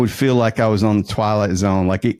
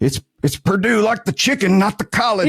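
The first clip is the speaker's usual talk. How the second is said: high-pitched Southern protest; Southern accent; 10 semitones higher than usual. Retold or imitated. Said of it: "with my Southern accent".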